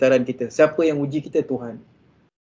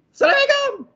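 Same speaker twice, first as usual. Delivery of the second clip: "Assalamualaikum" clipped and shrieked much higher; shrill snappy greeting. Shown as a demonstration. Wife coming home greeting husband harshly, high and sharp. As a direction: snap it out like an angry tigress. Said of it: "Memang tak kena peluk".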